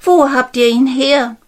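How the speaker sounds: excited princess; eager question. Acted. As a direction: press the maids for an answer.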